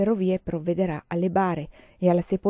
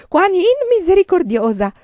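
put-on much higher, much louder old woman's scream; panicked shout; 12 semitones higher, 10 dB louder than usual. A narrator's reading. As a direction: cry out in terror at bandits. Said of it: "urlò la vecchia". (urlo di panico di una vecchia, molto più alto e forte)

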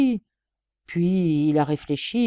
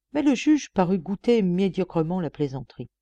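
ordinary delivery

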